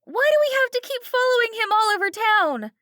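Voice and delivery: put-on high, loud pleading girl; Kelly crying out. A narrator's reading. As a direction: defend her dad, desperate. Kelly protests.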